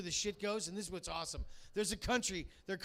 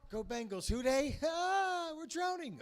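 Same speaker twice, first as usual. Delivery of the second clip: mock drowning fan; 7 semitones higher, slower than usual. Mock drowning Bengals fans.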